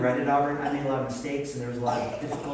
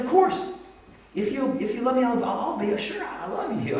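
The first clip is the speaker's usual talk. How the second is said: mock eager agreement; 8 semitones higher than usual. Mock eager listener reply.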